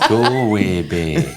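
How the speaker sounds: slow, laughing Irish lilt; laughing through a fake Irish accent; mock Irish accent. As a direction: stretch Galway Bay in a stage Irish accent. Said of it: "That's not the accent we were looking for".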